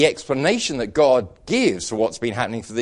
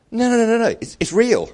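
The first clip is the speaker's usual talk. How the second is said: higher, insistent no, no, no; emphatic denial; 11 semitones higher, 4 dB louder than usual. Real, not acted. Preacher rebutting a doubting congregation, emphatic and higher. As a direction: wave the doubt away hard.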